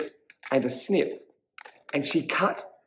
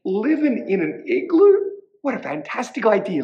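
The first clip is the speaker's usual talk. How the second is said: high, louder burst of delight; delighted little witch; 8 semitones higher, 5 dB louder than usual. A narrator's reading. Witch praises cat's igloo idea, high and excited. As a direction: start high on amazement, then speed up.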